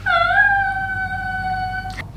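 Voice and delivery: long, very high thin squeal; stifled scream. Retold or imitated. Makeup artist reacts to client's outburst, mock panicked squeal.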